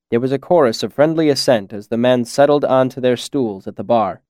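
ordinary delivery, nothing marked

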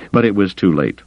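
ordinary delivery

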